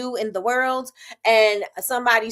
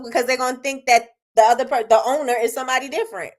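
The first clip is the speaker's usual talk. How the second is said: usual speaking voice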